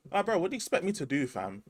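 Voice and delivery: a hint of a fed-up commuter, fast; quick, exasperated plea; lightly voiced passenger begging; imitating stuck commuter.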